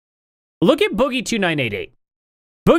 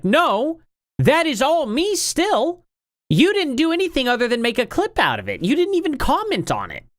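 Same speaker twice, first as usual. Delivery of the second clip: high, exasperated rebuttal to clip channels; mock indignant retort; 9 semitones higher than usual. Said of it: "And it's like".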